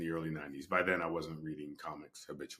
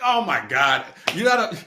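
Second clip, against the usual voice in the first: much higher, louder exasperated laughing outburst; 14 dB louder than usual. Retold or imitated. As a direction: throw up your hands at the son. Exasperated at son's disbelief.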